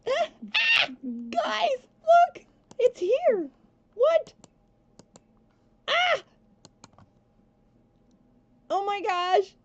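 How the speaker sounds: high, loud squeals of sudden joy; excited screaming. Real, not acted. Player finding the Baby Groot mod fixed, screaming with excitement. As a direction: shriek to your viewers in delighted disbelief. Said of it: "I'm sorry for screaming".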